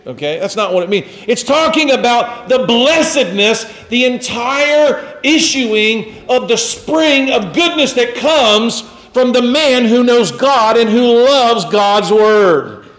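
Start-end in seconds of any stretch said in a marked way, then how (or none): 1.3-12.9 high, swelling sermon voice; emphatic preaching. Real, not acted. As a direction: drive the point home, drawing out key words.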